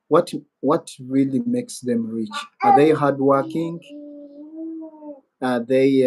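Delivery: normal talking voice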